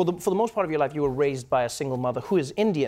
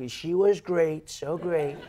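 imitating Trump; 4 semitones higher, much slower than usual. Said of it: "He was like".

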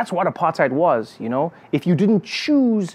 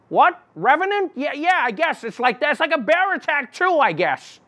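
higher pundit voice; 9 semitones higher than usual. Retold or imitated. Pundit asked follow-up.

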